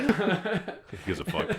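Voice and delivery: laughing. Real, not acted. Laughing dismissal.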